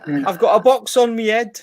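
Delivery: bad English accent. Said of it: "appalling attempt at an English accent".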